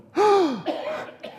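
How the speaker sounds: sharp high mock gasp of shock; imagined gasp. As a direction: gasp like a guard who just noticed. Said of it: "Abner looking down going".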